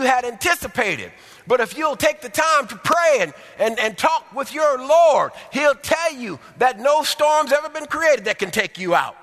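loud, high preaching voice. Acted. Preacher driving the sermon to the congregation, loud and high. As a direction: preach it with full force.

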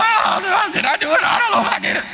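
high, flustered mock confusion; mock nervous kid. Schoolboy under the nun's stare, high mock panic. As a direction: play the rattled boy doubting himself. Kid doubting himself before nun.

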